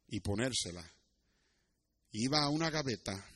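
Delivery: soft, low, solemn storytelling with long pauses; hushed parable narration. A narrator's reading. Preacher tells of the adopted boy's tearful ritual, hushed. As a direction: draw listeners in with a quiet, tender telling.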